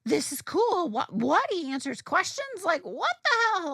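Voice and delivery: giddy, rising fan excitement; excited. Retold or imitated. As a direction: gush like a starstruck fan. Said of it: "I was excited".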